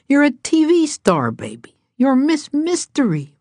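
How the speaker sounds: high, gushing encouragement; excited cheering. A narrator's reading. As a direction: hype the star up. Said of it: "Papa told her".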